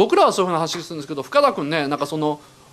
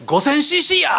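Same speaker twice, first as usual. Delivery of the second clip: Fukada's boast; 10 semitones higher, 4 dB louder than usual. Retold or imitated. Fukada blurts his claim.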